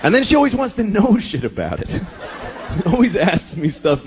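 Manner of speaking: ordinary delivery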